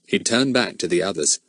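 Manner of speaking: ordinary delivery, nothing marked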